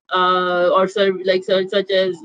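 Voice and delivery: ordinary delivery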